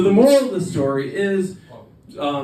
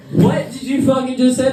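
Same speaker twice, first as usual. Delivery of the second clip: high, indignant mock disbelief; acted incredulous challenge; stunned outburst; 8 semitones higher, 4 dB louder than usual. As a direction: burst out as if you can't believe your ears. Acted stunned reaction to girl.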